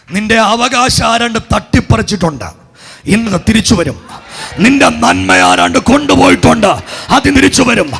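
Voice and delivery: loud, rhythmic declaration climbing high; full-force preaching. Real, not acted. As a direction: build to a shouted prophetic climax.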